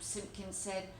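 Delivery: usual speaking voice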